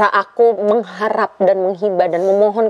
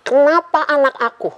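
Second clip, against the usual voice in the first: higher, anguished cry of why; 6 semitones higher than usual. Retold or imitated. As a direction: plead to God in grief, pitched up. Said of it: "Aku gitu sampai nangis".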